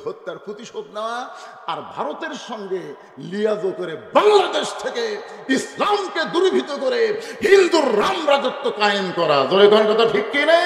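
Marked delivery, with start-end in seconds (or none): 1.7-10.6 loud, slow, building denunciation; full-force preaching. Real, not acted. Preacher accusing her before the crowd, full-force preaching. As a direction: drive it up to a roared appeal to the crowd.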